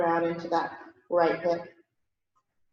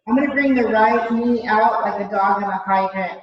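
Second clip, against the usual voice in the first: usual speaking voice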